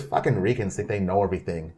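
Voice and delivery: put-on gangster quote in a Boston accent. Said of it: "You do a good Boston accent".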